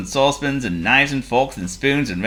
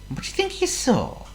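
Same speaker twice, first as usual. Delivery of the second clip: high, hushed teasing question; 14 semitones higher, 5 dB quieter than usual. A narrator's reading. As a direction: ask the children with suspense. Storyteller asks listeners, high voice.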